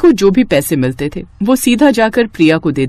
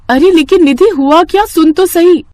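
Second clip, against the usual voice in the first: pleading call, higher and louder; calling after; 8 semitones higher, 4 dB louder than usual. Acted. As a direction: call out as she walks away. Priya calling after Nidhi.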